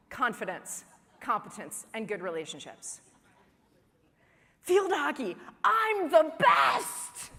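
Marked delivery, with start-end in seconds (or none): 4.6-7.3 high, loud, stretched-out triumphant cheer; proud kid boast. Acted. Child at field hockey, loud drawn-out proud boast. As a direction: crow it like a kid who just won.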